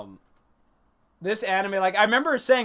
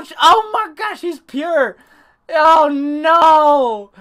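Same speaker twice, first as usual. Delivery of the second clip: loud, high cries of dismay; theatrical wail of alarm; hammed-up horror; 9 semitones higher, 12 dB louder, much slower than usual. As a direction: cry out as if watching the girl get grabbed. Reliving horror for little girl.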